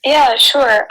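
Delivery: ordinary delivery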